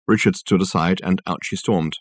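plain reading voice

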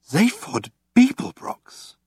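faint stunned Zaphod Beeblebrox; weakly. A narrator's reading. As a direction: breathe Zaphod Beeblebrox out in shock. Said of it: "he said weakly".

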